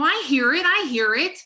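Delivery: loud, high, worked-up rant; retold angry outburst. Wife to husband mid-argument, loud and fired up. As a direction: let the adrenaline run the words. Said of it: "I was so freaking angry".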